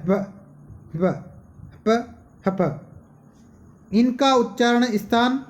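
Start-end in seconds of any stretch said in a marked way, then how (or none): 0.0-3.9 slight ह breath before each प and फ; upadhmaniya demonstration. Shown as a demonstration. Teacher demonstrating half visarga before प and फ, slight ह first. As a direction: puff a little ह into प, फ, in isolation. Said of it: "पहले थोड़ा सा ह बोलना पड़ता है".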